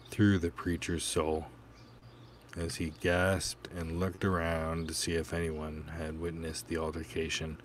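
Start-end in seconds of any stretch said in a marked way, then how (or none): none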